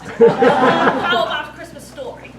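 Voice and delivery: loud laughing suggestion; bored prince laughing, wanting a story. Acted. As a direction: laugh out loud while proposing. Bursts out laughing.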